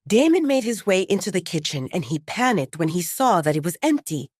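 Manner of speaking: ordinary delivery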